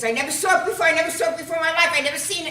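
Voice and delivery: high, loud, hurried protest of innocence; frantic repeated denial; panicked denial. Acted. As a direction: tumble it out fast and high. Fat Vinny denies before cops.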